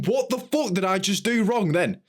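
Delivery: high, bewildered what-did-I-do; shocked thought, voiced high; baffled inner outrage. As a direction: pitch up in disbelief.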